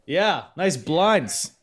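loud, cocky trash-talk promo; wrestling promo. Acted. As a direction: cut a heated heel promo at him. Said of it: "I'm just gonna cut a promo while he's gone".

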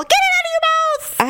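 high, loud parent yell; shouting. Retold or imitated. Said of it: "You're all".

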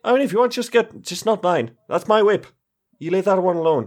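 high-pitched, defensive Irish character; Irish accent. Acted. Triss warns others off the weapon, in a light Irish accent. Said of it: "Triss has always been Irish".

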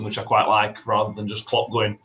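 ordinary delivery

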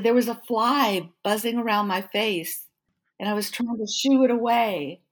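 imitating someone earnestly explaining himself; acted-out explanation.

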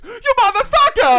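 high, loud cartoon road rage; Mario. Driver at a kid crossing the street, Mario yell. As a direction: yell it as an outraged game plumber. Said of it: "schizo talking in Mario voice".

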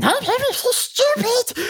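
very high, slow, squeaky scolding voice; talking to the Hoover. Pretend. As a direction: scold the vacuum like a silly pet in a high squeak. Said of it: "I'm giving him a persona".

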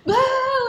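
high, loud imitated bawl; mock crying wail. Student reenacting crying in coworker's arms, high mock wail. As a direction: act out bursting into tears with a wail. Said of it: "just started crying in his arms".

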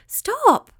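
very high, urgent cry; storybook shout. A narrator's reading. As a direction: shout it high, desperate to halt them. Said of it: "shouted Winky".

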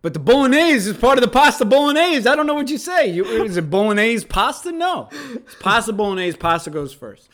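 loud, high, worked-up ranting; getting wound up over food order; excited rant. Real, not acted. Heated argument with himself.

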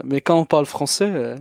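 French phrase in native Quebec accent. Real, not acted. Guest switching into his Quebec French. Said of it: "we change our language".

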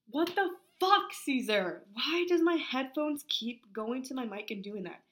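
loud, high exasperated swearing; annoyed outburst. Real, not acted. Annoyed at the headphones to Caesar, loud and high. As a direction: blurt it out, fed up with the gear. Headphone noise interrupts recording.

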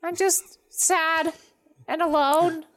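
high, slow, self-pitying whine; sad lonely voice. Acted. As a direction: pitch up and plead for company. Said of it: "and she's like".

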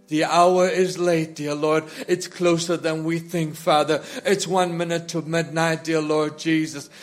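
ordinary delivery

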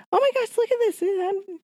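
very high, wide-eyed squeal; excited squeal. Retold or imitated. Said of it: "I just".

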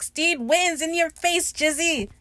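high, drawn-out mock victory jeer; gloating taunt. [name] taunting the loser Izzy, gloating. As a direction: rub the win in, stretched and high.

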